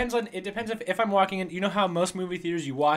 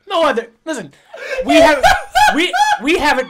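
helpless laughter breaking the words; laughing hard; 10 dB louder than usual. Real, not acted. Laughing at alien question.

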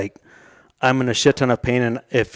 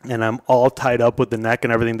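ordinary talk, nothing marked

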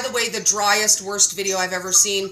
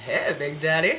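low, softer, mock sultry purr; teasing a diet video host who just said bacon; mock seductive; 8 semitones lower, 5 dB quieter than usual. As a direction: vamp it up at the bacon man. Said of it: "And I was like".

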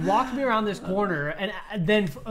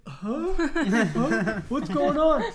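higher, clueless mock confusion; bewildered; 6 semitones higher, much slower than usual. Nerdy resident facing armed police, comically bewildered. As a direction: act the harmless, puzzled nerd with a laugh. Acts himself standing bewildered outside.

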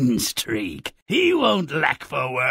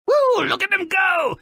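gleeful cheer. Acted. Host cheers the monster fight.

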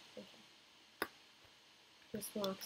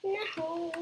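high, sharp "No!"; dismayed cry; 9 semitones higher, 3 dB louder than usual. Real, not acted. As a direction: cry out at the fall. Falling in the game.

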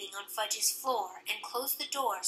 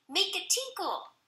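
put-on little girl; 10 semitones higher than usual. A narrator's reading.

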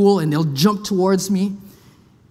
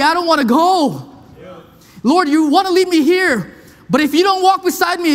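high, impassioned pleading preacher; full-force preaching; 6 semitones higher than usual. Acted. Preacher voices Moses begging God not to send him alone.

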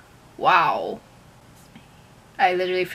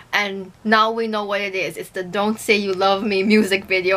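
ordinary talk, nothing marked

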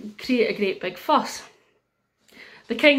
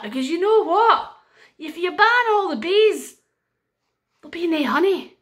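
warning wise woman; 7 semitones higher, 5 dB louder than usual. Acted. Wise woman warns the king.

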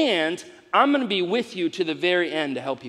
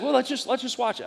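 wife's voice; 7 semitones higher than usual. Retold or imitated. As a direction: voice the wife coaxing lightly. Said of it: "And she's like".

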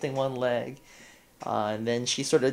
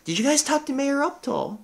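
much higher, sing-song ditzy question; ditzy chirp; 11 semitones higher than usual. Acted. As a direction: chirp it like a scatterbrain. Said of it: "and says".

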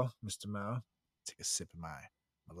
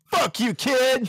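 mock yell; 10 semitones higher, 6 dB louder, slower than usual. Mock telling off the kid.

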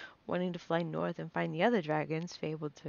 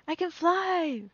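high, loud cry of triumph; high-pitched jubilant yell; exultant shout; 12 semitones higher, 8 dB louder, slower than usual. A narrator's reading. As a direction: shout it with a child's elation. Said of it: "Lara shouted and laughed".

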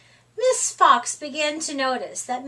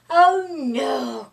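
cried; 4 dB louder than usual. Acted. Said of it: "cried Mouse".

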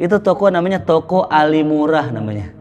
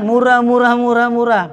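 loud, much higher street-hawker cry of murah; hawker's call; 8 semitones higher, 3 dB louder than usual. Retold or imitated. Jeddah shop seller shouts murah to pilgrims, a loud high hawker's cry. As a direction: shout the prices like a market seller. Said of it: "Begitu tukang dagangan".

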